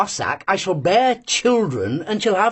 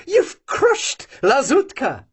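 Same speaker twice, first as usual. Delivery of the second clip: plain reading voice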